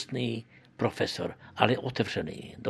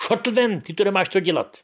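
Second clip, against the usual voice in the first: much higher, much louder furious bellow; imitated roar; 10 semitones higher, 7 dB louder than usual. Chairman roaring at the young scout to get out, furious bellow. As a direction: roar him out of the room. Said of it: "zařval".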